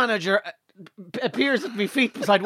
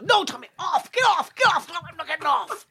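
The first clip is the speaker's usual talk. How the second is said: mock shouting manager; 8 semitones higher than usual. Tour manager shouting him off.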